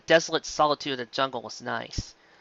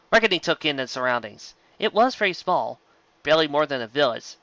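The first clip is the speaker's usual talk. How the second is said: ordinary delivery, nothing marked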